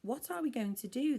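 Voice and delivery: put-on high panicked cry of many kids; alarmed yell. A narrator's reading. Kids yelling to each other in alarm. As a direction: shout it out in a panic. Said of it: "they yelled".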